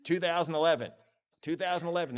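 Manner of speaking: mock excitement. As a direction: bounce back up with excitement. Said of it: "This is like an emotional whirlwind".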